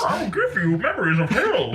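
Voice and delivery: Bane. Acted. Co-host mocks actor as a movie villain, slow impression. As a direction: stretch it out in a muffled comic villain voice. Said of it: "You sound like Bane".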